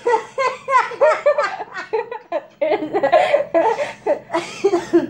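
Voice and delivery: long, high fit of giggling; real laughter. Real, not acted. Cracking up after tasting air.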